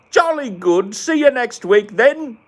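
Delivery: high, loud, slow posh British voice; mock British accent. Gamer playing a Brit ending the chat, in a hammed-up British accent. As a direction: ham up a jolly Brit signing off. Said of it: "this British thing is hard".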